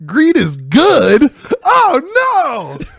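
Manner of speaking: high, loud, slow dramatic gasp; mock shock. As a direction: gasp like a cartoon villain.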